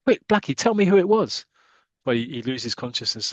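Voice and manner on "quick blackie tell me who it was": higher, pressing urgency; Roy urging the injured Blackie; urgent plea. Acted. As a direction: push him to speak before he fades.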